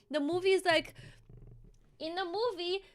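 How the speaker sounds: ordinary talk, nothing marked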